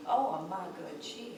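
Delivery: ordinary delivery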